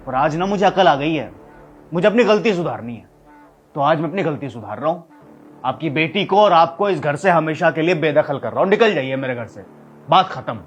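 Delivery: loud, heated angry declaration; flaring up. Acted. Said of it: "जो आप इतना भड़क रहे हो".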